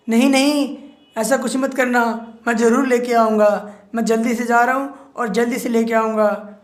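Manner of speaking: frantic pleading. Acted. Lagandev panics and promises the witch to return. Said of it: "Lagandev कहता है".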